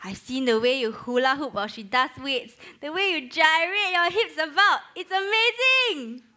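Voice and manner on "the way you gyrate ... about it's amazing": high, excited gushing; gushing praise. Acted. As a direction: overeager compliment, rising in pitch. Gushing compliment to a friend.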